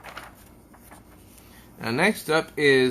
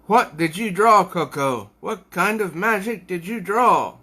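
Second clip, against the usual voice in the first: high, loud menacing demand; Queefrey questioning Coco; 7 semitones higher, 5 dB louder than usual. Acted. Queefrey demanding answers from terrified Coco, loud and high. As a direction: bark the question at the frightened girl.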